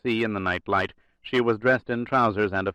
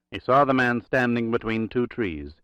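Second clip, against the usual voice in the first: ordinary delivery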